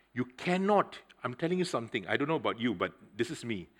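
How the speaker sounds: usual speaking voice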